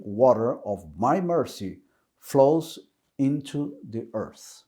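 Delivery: slow, deliberate quotation with pauses; measured, emphatic reading of important words. Real, not acted. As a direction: read it slowly, giving each phrase weight. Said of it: "I'm gonna read this very slowly".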